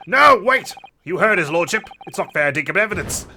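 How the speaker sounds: loud, high outburst easing off; acted courtroom shout, then settling; urgent protest. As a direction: burst in to object. Juror calls out an objection.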